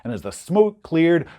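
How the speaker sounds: ordinary delivery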